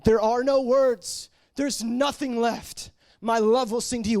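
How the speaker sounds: high, drawn-out groan of longing; high, slow, impassioned lament to God; groaning worship. Retold or imitated. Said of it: "And I'm just groaning".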